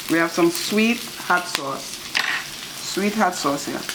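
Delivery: normal talking voice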